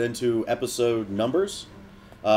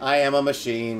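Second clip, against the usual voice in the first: robot voice. Pretend. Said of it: "I'm a robot".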